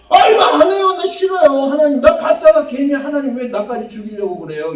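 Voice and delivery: high, loud whining outburst, easing as it runs; emotional protest demo. Shown as a demonstration. Preacher shows how Elijah's verse should sound, loud high protest. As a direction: burst out in a dramatized tantrum of refusal. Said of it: "이렇게 읽어야 되는 거예요".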